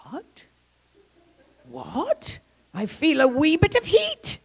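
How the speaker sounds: high, astonished Irish lilt; Irish accent. Retold or imitated. Irish cop startled by healing, mock Irish accent. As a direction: put on a high Irish brogue. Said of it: "He must be Irish".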